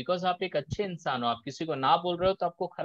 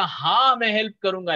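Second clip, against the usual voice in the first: body saying yes; 4 semitones higher, 6 dB louder, faster than usual. Shown as a demonstration. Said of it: "आपका body बोलेगा".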